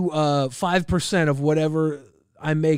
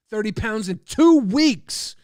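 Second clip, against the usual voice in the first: slow, louder, hitting two; stress on two; 4 semitones higher, 5 dB louder, slower than usual. Acted. Streamer hammering his weight loss at doubters, slow with two stressed. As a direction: spell it out slowly, punch two. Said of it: "emphatic boast of weight loss".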